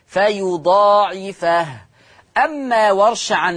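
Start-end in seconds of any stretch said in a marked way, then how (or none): 0.1-1.7 slow recited فيضاعفه as fa-yudaa'ifah; drawn-out Hafs فيضاعفه; fatha on fa. Shown as a demonstration. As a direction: open the last fa of فيضاعفه with an "a". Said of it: "يقرأ بفتح الفاء".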